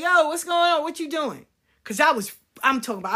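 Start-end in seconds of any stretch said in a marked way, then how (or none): 0.0-1.5 loud, higher call; rescuer calling out. Retold or imitated. The friend who walked up, calling out loud. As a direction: call out across the yard. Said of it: "it was like".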